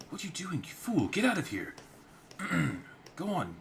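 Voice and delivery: high, loud scolding; angry yell. Acted. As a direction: chase him out angrily. Yelling at drunkard.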